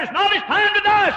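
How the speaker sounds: ringing, high proclaiming; voice of God. Retold or imitated. Preacher voicing God's answer, loud and high. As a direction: declare it like a divine verdict. God's word retold at full force.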